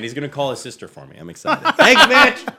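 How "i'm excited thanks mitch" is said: laughing. Real, not acted. Joking about host's sister.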